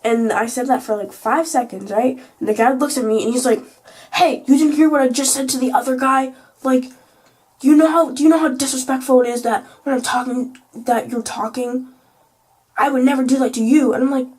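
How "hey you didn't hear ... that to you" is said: lightly hammed-up telling-off, louder; mock an affronted teacher with raised volume; imitated teacher scolding. Vlogger imitates sub scolding a student, mildly indignant.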